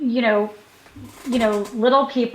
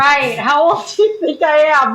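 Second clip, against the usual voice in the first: wry retort; 3 semitones higher, 4 dB louder than usual. Retold or imitated. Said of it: "And I'm thinking".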